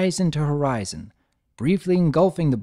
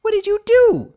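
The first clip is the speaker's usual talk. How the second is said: high, loud, panicked cry; shrill alarm, much louder; scared filly shouting at friend; 8 dB louder than usual. Acted. Alarmed filly after magic flash.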